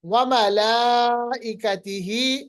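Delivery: slow, drawn-out tajweed chant; Quran recitation. Shown as a demonstration. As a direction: recite it like in prayer, letting vowels stretch. Teacher recites Quran words.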